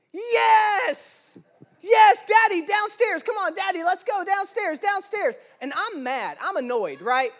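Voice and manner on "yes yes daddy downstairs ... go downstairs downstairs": put-on high, loud, eager little boy; excited toddler. Toddler begging daddy to go downstairs, high and eager. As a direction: jump at the offer like a thrilled two-year-old. Son thrilled by hot dogs offer.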